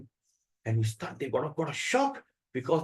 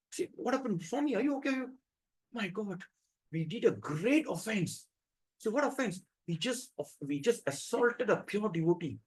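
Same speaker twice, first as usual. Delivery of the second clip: soft, higher, anxious murmur; hushed worry; 5 semitones higher, 6 dB quieter than usual. Retold or imitated. The priests whisper in fear after hurting the devotee, much softer.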